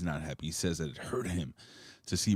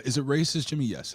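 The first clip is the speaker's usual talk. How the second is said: normal talking voice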